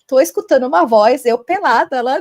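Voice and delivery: loud mock alarm; retold panic. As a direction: retell your panic loudly, half laughing at it. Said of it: "eu comecei a ficar apavorada".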